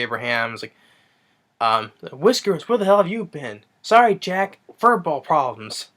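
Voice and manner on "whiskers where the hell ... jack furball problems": higher-pitched cartoon exchange; hammed-up buddy banter; cartoon movie dialogue. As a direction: act out a cartoon cat reunion. Voices cartoon cat exchange.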